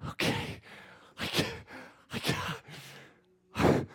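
whispered, out of breath; exhausted whisper. Retold or imitated. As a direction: gasp it out like you're spent. Worn out after forty days.

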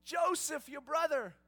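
very high, loud, beaming announcement; joyful reveal. Retold or imitated. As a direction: burst out with the big reveal. Joseph revealing himself to brothers.

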